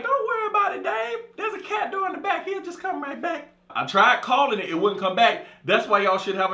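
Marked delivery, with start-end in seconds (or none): 0.0-3.5 women's voice. Retold or imitated.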